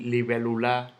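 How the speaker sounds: libélula stressed on LA, libelu-LÁ; wrong final stress. Shown as a demonstration. Teacher rejecting a wrong version, libélula stressed on final LA. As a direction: land on the end of libélula. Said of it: "Yo no digo libélula".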